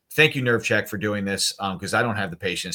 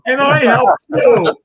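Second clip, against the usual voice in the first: high, drawn-out mock boast; mock proud helper; 13 dB louder, much slower than usual. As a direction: shout it slowly, way up high. Mocks someone claiming a small contribution.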